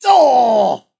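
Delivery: long, loud, high "oh" falling away; loud protesting wail. Acted. Boy just grounded by his dad, loud drawn-out wail.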